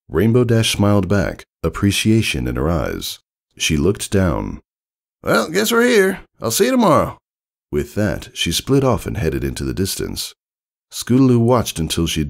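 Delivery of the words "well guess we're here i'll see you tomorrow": high, casual farewell; tomboy voice. Acted. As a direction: say goodbye to the kid. Rainbow Dash voiced.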